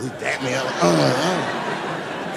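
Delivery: normal talking voice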